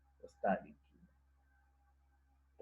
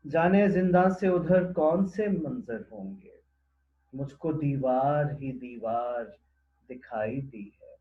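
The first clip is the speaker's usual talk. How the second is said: poem recitation; 3 semitones higher, 7 dB louder than usual. Acted. Presenter repeating the prison couplet after explaining it, poem recitation. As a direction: declaim the verse, easing off gradually.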